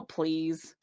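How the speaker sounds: scoffing, drawn-out "please", low and soft; daring the cliff to try harder, mock unimpressed; cocky scoff. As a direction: brush off the mountain like it's nothing. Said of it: "you're gonna look at that and go".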